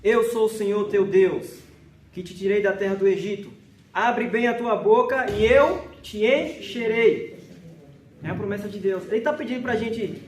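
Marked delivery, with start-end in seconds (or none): 0.0-7.4 loud, slow, measured proclamation of scripture; emphatic scripture reading. Real, not acted. Preacher reads God's promise to the congregation, emphatic scripture reading. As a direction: proclaim each phrase loudly, drawing out the words.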